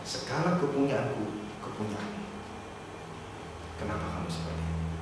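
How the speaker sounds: very soft, low and gentle; father embracing his older son, tender. Retold or imitated. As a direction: plead lovingly with the son.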